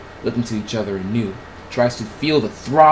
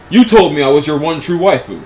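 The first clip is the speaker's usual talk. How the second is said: loud, higher-pitched accusation; hurt outburst; 5 semitones higher, 10 dB louder than usual. A narrator's reading. Confronting Trihex over the one true waifu, loud hurt outburst. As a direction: throw it out loudly as a betrayed lover.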